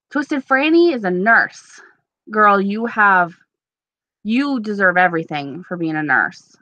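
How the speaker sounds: ordinary delivery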